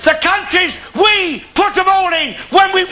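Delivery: builds into a shouting rant; bigot's tirade rising to a shout. Acted. Alf ranting, building.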